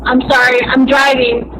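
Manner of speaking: normal talking voice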